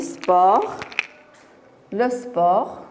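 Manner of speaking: slow "sport" ending on the r; silent final T. Shown as a demonstration. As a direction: leave the T of sport unsounded. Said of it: "la lettre finale muette". (sport lentement, T final muet)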